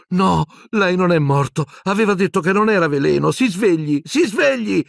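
high, urgent pleas building to a cry; desperate pleading. A narrator's reading. Boy shaking his unconscious professor, desperate pleading. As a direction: beg him frantically to wake. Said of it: "supplicò Harry scuotendolo".

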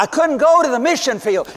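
normal talking voice

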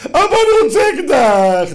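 put-on high, loud, slow child's plea; a child demanding a toy gun; whiny child. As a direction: beg like a spoiled kid. Child begging father for gun.

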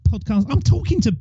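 high, soft panicky protest; mock panic. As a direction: act out a panicked beginner's self-doubt. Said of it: "kind of a big panic part".

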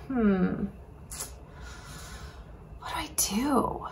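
soft hmm, then a whispered question; puzzled whisper. Acted. Aaron, stuck, whispers to himself in a puzzled hush. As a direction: wonder aloud under your breath.

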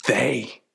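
"They" set apart as a quoted word; single out "They", pausing after it; pointed, isolated "They"; stress on THEY. Retold or imitated. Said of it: "the language they used".

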